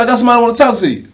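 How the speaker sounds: Rob Ryan on the phone. Retold or imitated. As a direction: rush out the line with excitement. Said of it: "He said".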